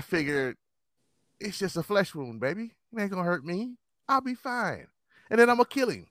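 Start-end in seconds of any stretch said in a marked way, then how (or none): 1.4-6.1 high, carefree tough-guy talk; mock invincible swagger; cocky bravado. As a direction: shrug off a bullet. Voicing his reckless younger self.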